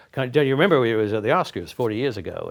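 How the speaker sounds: a badly faked American accent, pressing the point. Shirley MacLaine recalls the Oscars, weak American accent. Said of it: "I can't do American accent very well".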